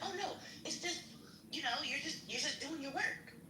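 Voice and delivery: high, hesitant imitation of a boss; mock hedging. Sergeant tiptoes around criticism, retold.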